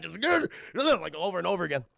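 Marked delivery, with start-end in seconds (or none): 0.2-1.0 high, loud effort grunts; hammed-up animal grunting; gorilla-like grunts. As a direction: grunt with each smashing blow. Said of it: "like a gorilla or something like that".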